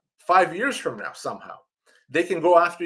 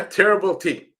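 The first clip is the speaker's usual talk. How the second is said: chuckling through the name terrible T; laughing; 5 semitones higher, 3 dB louder than usual. Real, not acted. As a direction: laugh while saying terrible T. Laughs at the username.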